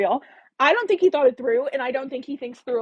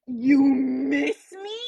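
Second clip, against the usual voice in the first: incredulous mock; 3 semitones higher, much slower than usual. Mocking Colin's line in disbelief.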